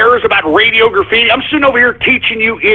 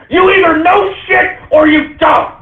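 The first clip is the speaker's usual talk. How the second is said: furious shout; 8 semitones higher than usual. Real, not acted. Host shouting the refrain at viewers, furious. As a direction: hammer the refrain in a rage.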